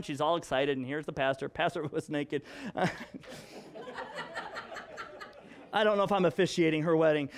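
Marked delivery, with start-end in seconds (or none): 2.5-5.2 real airy laugh, no voice, rising up high; breathy silent laugh. Real, not acted. Father tells of the naked pastor doll, wheezing silent laugh. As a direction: let a silent laugh escape.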